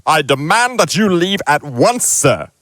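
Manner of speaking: high, indignant uncle voice; puffed-up and outraged; pompous demand. Acted. Uncle Vernon ordering the giant out, high and indignant. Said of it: "he said".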